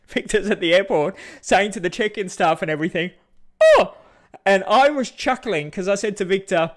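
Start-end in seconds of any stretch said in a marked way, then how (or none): 3.6-3.9 very high, loud yelp; mock shocked oh. Victor startled at the airport check-in, a high loud yelp.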